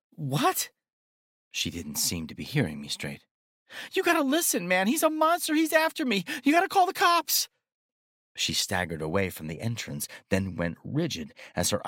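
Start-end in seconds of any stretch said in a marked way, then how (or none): 0.1-0.7 panicked woman. A narrator's reading. Frightened woman confused. 3.9-7.5 high, loud frantic pleading; terrified young woman begging; panicked woman. A narrator's reading.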